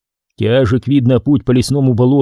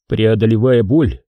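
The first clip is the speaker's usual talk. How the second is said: ordinary delivery, nothing marked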